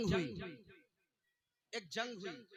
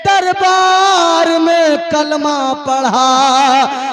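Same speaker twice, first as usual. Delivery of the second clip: high, slow, shouted climax; preaching shout; 5 semitones higher, 5 dB louder, much slower than usual. Acted. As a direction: belt the line out slowly. Climax of recited verse.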